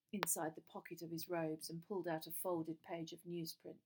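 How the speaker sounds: ordinary delivery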